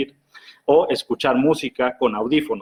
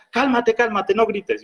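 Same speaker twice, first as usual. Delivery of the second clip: high, exasperated calm-down plea; mock angry plea; 10 semitones higher than usual. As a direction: play someone pleading mid-fight to stop yelling. Acted heated argument, first party. (súplica exasperada, aguda)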